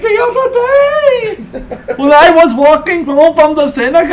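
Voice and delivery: voice in his head. Acted. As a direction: holler it slow and high like a mock stand-up comic. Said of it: "That's the voice in my head".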